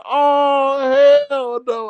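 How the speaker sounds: high, drawn-out mock disbelief; shocked disbelief. Host reacting to the Austin news, drawn-out disbelief. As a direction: stretch it out high, scandalised.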